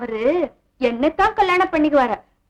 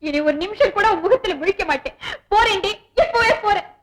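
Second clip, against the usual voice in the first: put-on sob, voice rising higher as she storms off; fake crying; 4 semitones higher than usual. Staged for her boss, a hammed-up tearful walkout. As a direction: pretend to weep and threaten to leave. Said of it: "பிரமாதண்டி உன் நடிப்பு".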